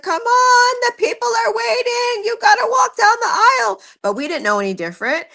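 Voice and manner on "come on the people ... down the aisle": much higher, louder urging; urgent high coaxing. Retold or imitated. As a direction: coax her down the aisle. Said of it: "We were like".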